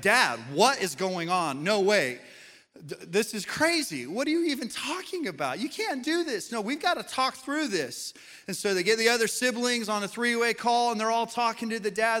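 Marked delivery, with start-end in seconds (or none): 0.0-8.1 a shocked daughter pleading with her dad, higher and higher; alarmed daughter. Retold or imitated. Daughter on the phone hearing her parents will split, alarmed and pleading. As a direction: pitch climbing high in disbelief. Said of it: "And the daughter's like".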